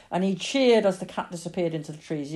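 ordinary delivery, nothing marked

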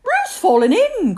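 high, loud cry of alarm. A narrator's reading. Rabbit raises the alarm about Roo, crying out. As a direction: cry it out urgently. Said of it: "cried Rabbit".

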